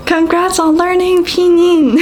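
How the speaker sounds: high, loud congratulations; celebratory cheer. Real, not acted. Teacher to learners who finished pinyin, high excited cheer.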